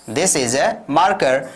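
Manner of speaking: slow, clear classroom drill; slow model sentence. Shown as a demonstration. Teacher drilling simple sentences slowly for learners. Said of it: "आपने कह दिया".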